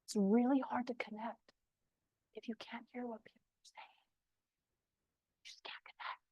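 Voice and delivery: barely audible unvoiced whisper; fading into a nearly silent whisper. Shown as a demonstration. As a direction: whisper it so faintly the room strains to hear. Acts out speaking too quietly.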